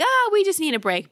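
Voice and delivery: high, light mock excuse; avoiding partner. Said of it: "you're like".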